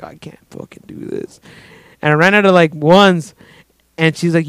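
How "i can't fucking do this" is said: under-the-breath, barely voiced exasperation; muttered aside. Broke customer to himself at the strip club, muttered. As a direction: mutter it privately to yourself. Retelling his muttered private panic.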